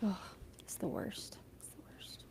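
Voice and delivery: very soft, voiceless whisper; barely audible lament; hushed dismay. Real, not acted. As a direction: whisper it, as if too upsetting to say. Dismayed at animals dying in films.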